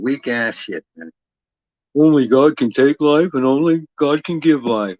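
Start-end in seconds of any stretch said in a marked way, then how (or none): 2.0-5.0 raised, louder sanctimonious preacher voice; mock pious believer. Mocking Christians who lecture him, in a sanctimonious preacher voice. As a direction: parrot the believers with a holier-than-thou lilt.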